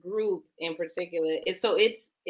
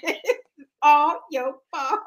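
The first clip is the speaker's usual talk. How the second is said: laughing repeat; 5 semitones higher, 4 dB louder, much slower than usual. Acted.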